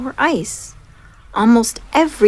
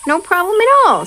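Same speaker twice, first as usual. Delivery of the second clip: high, louder bright exclamation; upbeat hippo brushing off worry; cheerful reassurance; 10 semitones higher, 6 dB louder, faster than usual. Acted. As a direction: toss it off gladly. Henry laughs, reassures.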